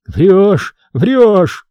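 high tearful protest; acted weeping, high and insistent; crying. A narrator's reading. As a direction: wail the denial, pitched high. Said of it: "плакал поп".

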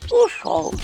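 high disappointed cry; sad sigh. Acted. Imp sadly sighing the spirit escaped. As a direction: let it out with a sigh. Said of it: "грустно вздохнула Анчутка".

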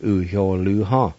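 "U-hyo-lu-ha" with h before "hyo", falling at the end; Cherokee pronunciation. Shown as a demonstration. Teacher repeating "U-hyo-lu-ha" for learners, calm demo. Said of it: "Kinda like this".

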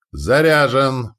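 slow loud drawn-out call; shouting. A narrator's reading. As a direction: stretch the shout out. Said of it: "прокричал Вага".

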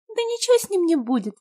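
put-on high, scornful girl's voice; disdainful. A narrator's reading. Люба dismisses crying Петя, high disdainful voice. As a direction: sneer it off dismissively. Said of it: "брезгливо дёрнула губой Люба".

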